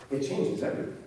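near-whispered, solemn echo; hushed repetition. Real, not acted. Preacher repeating the key claim, hushed. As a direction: let it sink in very softly.

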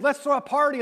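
a happy dad throwing open the doors; lightly voiced festive father; celebrating father.

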